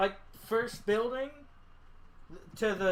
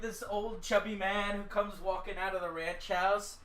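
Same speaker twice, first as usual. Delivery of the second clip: normal talking voice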